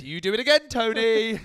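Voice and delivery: loud, high squeaky ref; referee. Retold or imitated. Mock referee voice warning Tony Adams. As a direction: plead with Tony like a scared ref.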